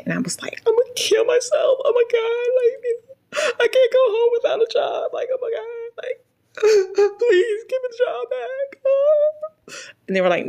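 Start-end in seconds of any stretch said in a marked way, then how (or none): 0.7-8.8 hammed-up high-pitched sobbing wail; imitating a desperate, tearful meltdown; crying. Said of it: "I was crying".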